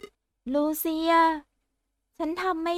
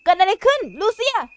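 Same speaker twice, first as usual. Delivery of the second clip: loud, higher horrified outburst; shocked shout; 10 semitones higher, 5 dB louder than usual. Acted. Doctor shouting in shock at what happened to Lucia. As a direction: yell it out in horror.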